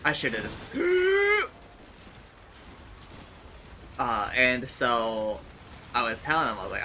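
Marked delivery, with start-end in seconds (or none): 0.5-3.9 high startled gasp; sharp gasp. Real, not acted. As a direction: suck in air in shock. Shock in game.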